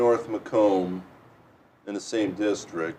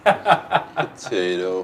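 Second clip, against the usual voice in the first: mock Irish accent; 2 semitones lower, 4 dB louder, much slower than usual. Echoing the mock Irish potato.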